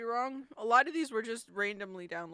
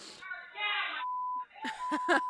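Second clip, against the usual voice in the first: crying laugh; 3 dB quieter than usual. Real, not acted. Shocked at clip of mother.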